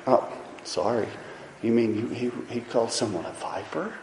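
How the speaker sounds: soft, low apologetic voice; timid, overly polite rebuke; mock timid apology. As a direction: apologize for every word. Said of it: "That's not Jesus".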